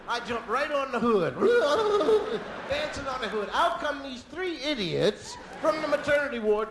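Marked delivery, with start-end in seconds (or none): 1.4-2.6 high, loud wobbling babble; comic wordless whoop; frantic whooping. Acted.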